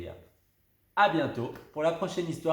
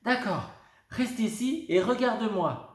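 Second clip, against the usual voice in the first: ordering; 8 semitones higher than usual. A narrator's reading. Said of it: "lui ordonne Lulu Grenadine".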